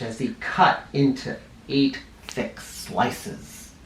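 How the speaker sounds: slow hushed whisper; slow breathy storybook whisper; suspenseful whisper. A narrator's reading. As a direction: whisper it like a secret. Suspense as pie is cut.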